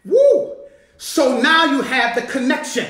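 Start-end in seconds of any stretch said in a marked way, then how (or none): 0.1-0.5 a high, loud woo of joy; excited whoop. Real, not acted. Preacher whooping at his church over the verse. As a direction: let out a joyful preacher's whoop.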